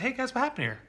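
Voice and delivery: defuse it, keep it light and kind; relaxed, reassuring check-in with the kids; calm friendly dad. Retold or imitated. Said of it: "Instead of having that old reaction".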